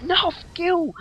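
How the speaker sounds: shutting down the dog in a high mocking voice; mock refusal. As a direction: reject the dog with a squeaky sneer. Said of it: "I'm like".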